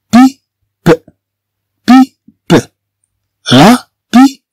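بيب said high and clipped as a French model; French pipe, high. Shown as a demonstration. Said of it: "بي إي تنطق بي". (نطق بيب بصوت عالٍ بالفرنسية)

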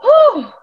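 high, loud sigh of relief; child's exhale of relief; relieved whew. Retold or imitated. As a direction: let the tension out with a big whew. Son's relief voiced.

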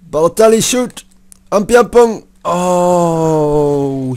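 high, loud shout at the shot; real gamer shout; excited yell. Real, not acted. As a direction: yell as the chance opens up. Excited FIFA player yells at goal chance.

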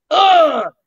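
sharp loud high grunt of impact; loud punched-out ugh; effort grunt. Shown as a demonstration. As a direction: grunt as if you just took the hit.

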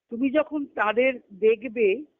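ordinary talk, nothing marked

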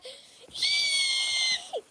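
high shrieking laugh of pure glee; excited laughter. Real, not acted. Vlogger thrilled at her book, high shrieking laugh.

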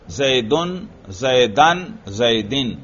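जैदुन, जैदन, जैदिन with endings -un, -an, -in; changing case endings. Shown as a demonstration. Teacher shows students how the word's ending changes, changing case endings. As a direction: recite जैदुन, जैदन, जैदिन, making each final vowel distinct. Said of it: "देखो, इसका आखिर बदल रहा है".

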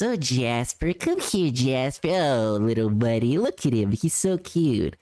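light mock baby talk, voice barely shifted. Co-host imitates the streamer cooing at his dog, light mock baby talk. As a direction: coo at the little dog, lightly mocking. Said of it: "baby talking like this".

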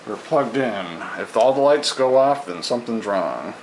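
ordinary talk, nothing marked

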